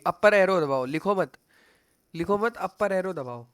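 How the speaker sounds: loud, insistent instruction; urgent correction. Real, not acted. Coach stopping a student from typing, urgent and loud. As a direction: call it out firmly to stop them.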